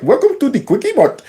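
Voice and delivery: put-on high-pitched caricature Indian accent; mock Indian accent. Host parodying a shopkeeper's greeting, mock Indian accent. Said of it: "that very heavy Indian accent".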